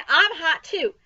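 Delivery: high, loud complaint; boy clamouring. A narrator's reading. Boy wanting water thrown too, clamouring. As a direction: call out loudly to be included.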